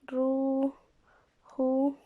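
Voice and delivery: stretched out syllable by syllable; very slow dictation. Shown as a demonstration. Teacher to students while writing, very slowly stretched. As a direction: drag each sound out as you write. Teacher writes word on board.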